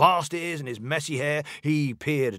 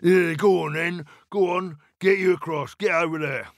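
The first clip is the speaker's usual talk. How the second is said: grudging troll, raised and loud; higher, impatient troll voice; 5 semitones higher, 5 dB louder than usual. A narrator's reading. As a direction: wave the goat across as a gruff troll. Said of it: "thought the Troll".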